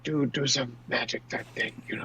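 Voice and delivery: very soft, near-whispered prompt to an accomplice; hushed aside. Acted. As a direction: mutter it covertly out of the side of the mouth. Covert prompt to accomplice mid-bluff.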